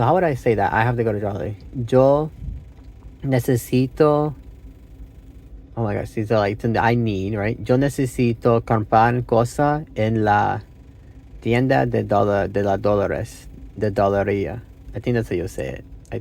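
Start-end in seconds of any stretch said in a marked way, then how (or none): 1.9-4.3 halting learner's Spanish, stretched out; broken Spanish. Real, not acted. Learner working out a sentence for practice, halting broken Spanish. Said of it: "in my broken Spanish". 7.7-14.6 slow, halting beginner's Spanish; broken Spanish. Real, not acted. Learner trying out a shopping sentence, halting broken Spanish. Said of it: "in my broken Spanish".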